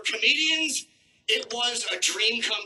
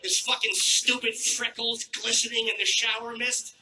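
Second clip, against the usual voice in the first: usual speaking voice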